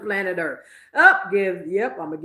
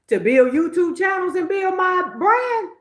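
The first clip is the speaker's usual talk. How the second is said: excited shout; 10 semitones higher, 4 dB louder than usual. Real, not acted. Proud climax of quitting story.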